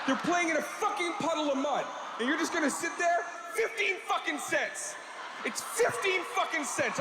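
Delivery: high, raging leather-jacket tough guy screaming at the viewer; furious tough guy. Acted. Leather jacket guy berating stingy viewers, furious yelling. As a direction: scream it like you want to shake them. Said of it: "a fucking dude in a leather jacket".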